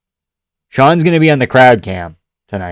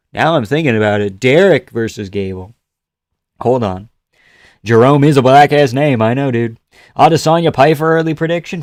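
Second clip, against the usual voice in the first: ordinary talk, nothing marked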